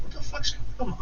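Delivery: high, soft imitation of mum; baffled mum. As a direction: put on a high, hushed bewilderment. Voices the baffled mum's reaction.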